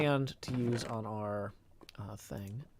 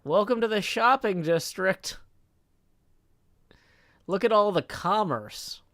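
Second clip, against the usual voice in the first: louder, higher showy greeter; hammed-up tour guide, raised voice; mock grand welcome; 6 semitones higher, 7 dB louder than usual. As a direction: play up a loud, grand welcome. Mock welcome to the shopping district.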